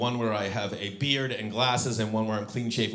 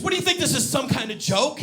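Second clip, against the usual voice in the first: high, louder indignant demand; pissed off; 11 semitones higher, 5 dB louder than usual. Retold or imitated. Marie confronting the prank caller, pissed off. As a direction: mimic an irate woman demanding an answer. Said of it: "Marie got pissed".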